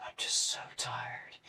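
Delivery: whispered, drained of energy; tired whisper. Retold or imitated. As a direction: let it out on a spent breath. Telling brother he can't get up.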